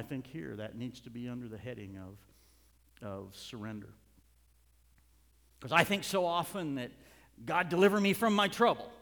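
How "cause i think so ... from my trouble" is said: loud, high-pitched sermon delivery; full-force preaching. Real, not acted. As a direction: drive it home to the congregation.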